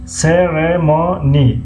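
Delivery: سرمونی very slowly as "se - re - mo - ni"; syllable by syllable. Shown as a demonstration. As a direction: break سرمونی into four long, separate syllables. Teacher sounds word out by syllables. («سرمونی» هجا به هجا و خیلی آهسته)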